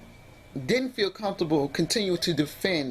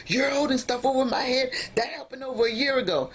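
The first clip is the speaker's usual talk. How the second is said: imitating partner's retort; 8 semitones higher than usual.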